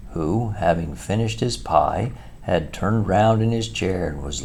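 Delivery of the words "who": plain reading voice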